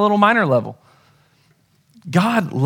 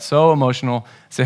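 usual speaking voice

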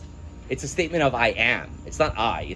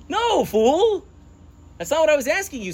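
much higher and louder, throwing up his hands at Esav; mock exasperated yell; 14 semitones higher, 5 dB louder than usual. Teacher scolds clueless Esav, high mock yell. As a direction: scold the fool loud and high.

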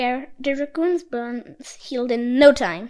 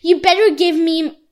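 furious yell; 3 semitones higher, 11 dB louder than usual. A narrator's reading. Priest barging into Jinbi's house, furious yelling. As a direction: storm in and demand your money. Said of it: "stomping in with a red face and yelled".

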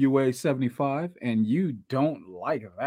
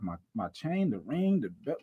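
ordinary delivery

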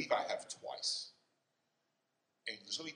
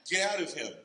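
loud, high acted exorcism command; forceful raised-voice command, acted out; commanding shout; 8 semitones higher, 10 dB louder than usual. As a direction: order the demon out at full volume. Imagines himself commanding a demon.

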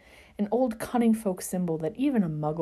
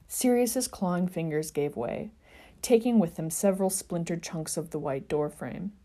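ordinary delivery, nothing marked

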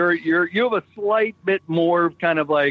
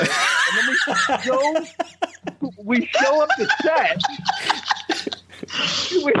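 laughing; 9 semitones higher, slower than usual. Real, not acted. Laughing while telling the story.

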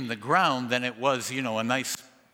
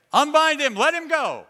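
much higher and louder order; commanding call; 8 dB louder than usual. Acted. Jesus ordering the crowd to unwrap Lazarus, loud. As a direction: free him, with authority.

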